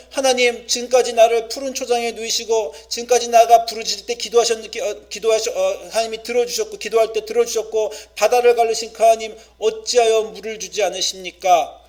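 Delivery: a thirsty people crying out to God; impassioned lament. Acted. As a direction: plead with God in desperation. Acting a lament over no water.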